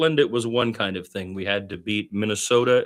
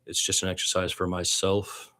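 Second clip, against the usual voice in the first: ordinary talk, nothing marked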